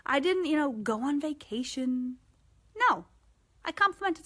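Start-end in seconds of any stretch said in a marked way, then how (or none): none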